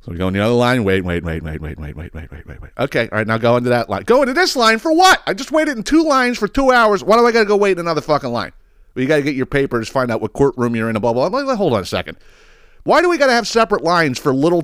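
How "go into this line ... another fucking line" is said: exasperated rant. Retold or imitated. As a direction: rant in frustration. Ranting at the line system.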